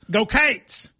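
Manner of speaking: "Cates" said with a long ay sound, Go Cates; Kentucky pronunciation. Shown as a demonstration. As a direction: Cats stretched to Cates, one syllable. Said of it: "Here, so really it's Go Cats".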